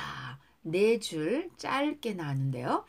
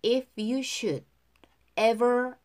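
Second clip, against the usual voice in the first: ordinary talk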